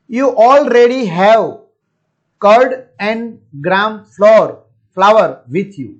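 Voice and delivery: slow emphatic reading. Shown as a demonstration. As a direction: lean on each word for the learners. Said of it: "teacher reads English line".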